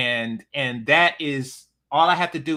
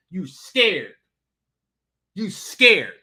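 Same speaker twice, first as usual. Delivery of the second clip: mocking jab, louder the second time; taunting; 5 dB louder, slower than usual. Real, not acted. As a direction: needle them with it.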